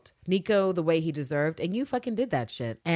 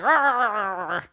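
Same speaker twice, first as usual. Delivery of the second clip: loud "rah-rah" growl; hammed-up macho roaring; mock alpha roar; 3 semitones higher, 6 dB louder than usual. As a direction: roar like a puffed-up alpha male. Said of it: "with his alpha energy".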